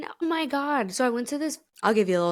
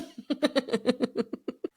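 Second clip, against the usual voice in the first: laughing; 5 semitones higher than usual. Real, not acted. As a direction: let a light laugh run out. Laughs at own disagreeing remark.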